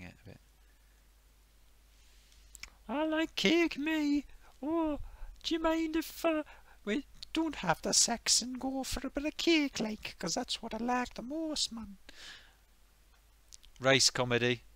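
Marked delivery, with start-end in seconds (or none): 2.9-12.0 Geordie woman impression. Mocking Geordie comedian again.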